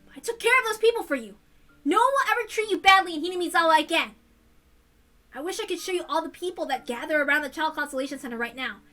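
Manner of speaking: put-on high, loud, excited boy calling out; excited friend reassuring her over the phone; eager teenage boy. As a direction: burst in eager to rescue her. Keiichi voiced on phone.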